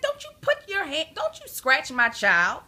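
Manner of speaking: put-on high, loud scolding of a child; mother's high-pitched telling-off; retold holler. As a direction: holler a protective warning, drawing out the end. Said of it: "I holler at that boy".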